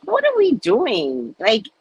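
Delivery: ordinary talk, nothing marked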